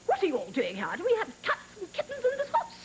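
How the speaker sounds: put-on nasty governess in a French-British accent; stern governess, exaggerated European accent; horrible governess. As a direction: scold in a put-on British accent, jumping up high. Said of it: "I had to sort of talk like".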